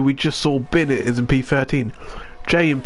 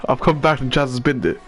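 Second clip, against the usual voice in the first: ordinary delivery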